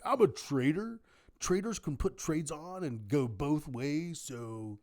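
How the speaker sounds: overconfident California trader dude; California dude accent. Acted. Imitating an overconfident trader, California dude accent. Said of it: "That's my California trader dude accent".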